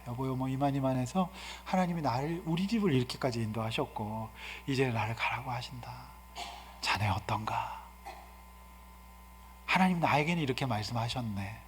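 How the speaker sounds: gentle hushed talk. Shown as a demonstration. Jacob calmly telling his wife God's call, hushed. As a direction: murmur it quietly, patient and kind. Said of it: "조용히 불러내서 조근조근".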